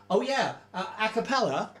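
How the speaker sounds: ordinary talk, nothing marked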